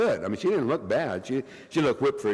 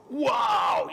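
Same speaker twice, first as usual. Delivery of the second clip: mock impressed crowd; 9 semitones higher than usual.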